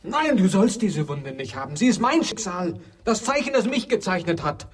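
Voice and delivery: immer höher werdende Verzweiflung; verzweifelt steigernd. Acted. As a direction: steigere dich in die Verzweiflung. (verzweifelter, steigender Ausruf)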